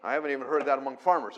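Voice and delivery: ordinary delivery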